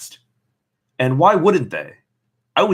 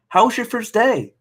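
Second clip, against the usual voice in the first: cheerful mom; 12 semitones higher than usual. Acted. Mother's cheerful question in story.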